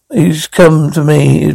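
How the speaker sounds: ordinary talk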